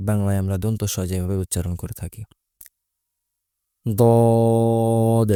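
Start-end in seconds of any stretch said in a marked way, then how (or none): 3.9-5.3 heavy d in দদ, stretched on one note; long-drawn দদ. Shown as a demonstration. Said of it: "তিন আলিফ পরিমাণ টান". (গলার গভীরে দদ লম্বা টেনে)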